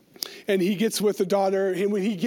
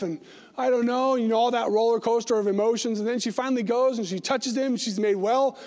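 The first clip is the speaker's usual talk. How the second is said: usual speaking voice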